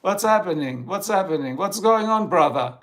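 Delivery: loud lazy American dude, overplayed; mock American slang. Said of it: "The Americans are just like".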